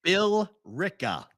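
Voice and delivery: Billerica drawn out slowly and pitched high as BILL-RICKA; careful enunciation. Shown as a demonstration. Local correcting co-host who can't catch the town name, Billerica slowly enunciated as BILL-RICKA. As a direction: sound out Billerica as two big beats, BILL, RICKA. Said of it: "What are you saying?".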